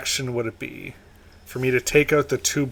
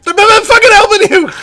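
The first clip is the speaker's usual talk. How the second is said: shouting; 11 dB louder than usual. Acted. Player shouts refusal in character.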